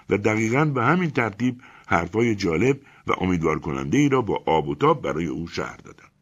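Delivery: plain reading voice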